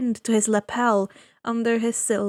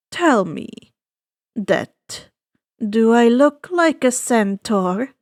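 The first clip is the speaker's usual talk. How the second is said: suave ferryman; 5 dB louder, slower than usual. Acted. Ferryman of the dead greeting the boy, slow and silky. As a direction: savor each word like a sly host.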